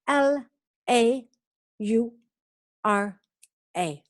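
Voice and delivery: L, A, U, R, A said one at a time; teacher spelling a name letter by letter. Shown as a demonstration. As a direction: spell it slowly for the class. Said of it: "My first name, [name]".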